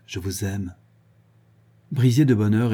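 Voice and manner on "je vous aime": warm voice. A narrator's reading. Guibray holding Liane in the stable, warm and soft. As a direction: breathe it to her, close and tender. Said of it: "d'une voix chaude".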